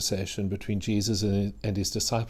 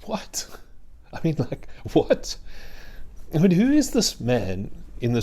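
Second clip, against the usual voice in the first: incredulous; 6 semitones higher than usual. Acted. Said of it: "we've got to say".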